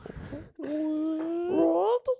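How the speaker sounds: shrill high-pitched creature squeal; gremlin squeal. Pretend. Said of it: "Sounds like a gremlin".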